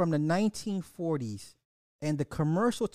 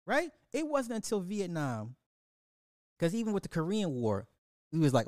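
ordinary talk, nothing marked

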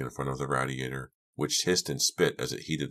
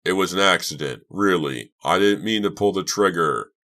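louder, raised protest of frustration; angry frustration; 4 semitones higher, 7 dB louder than usual. A narrator's reading. Doug protesting the shooting was an accident, loud and frustrated. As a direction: cry out in anguished self-defense. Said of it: "uttered a loud cry of anger, of frustration".

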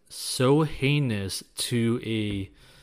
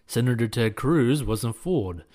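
news editorial read; 5 dB louder than usual. Acted. Reads a news editorial aloud.

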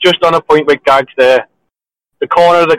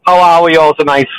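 smug, pitched-up greeting; 4 semitones higher, 3 dB louder than usual. Real, not acted. Caller greeting the panel after the game, smug. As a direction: greet them all very pleased with yourself. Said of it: "So smug".

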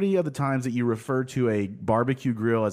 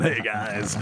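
announcer voice; 3 semitones lower than usual. Shown as a demonstration. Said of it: "This is our announcer voice".

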